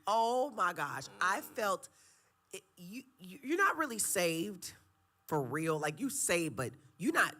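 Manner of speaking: high, loud preaching. Acted. Guest preaching to the host about correction. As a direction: lift it up like a preacher.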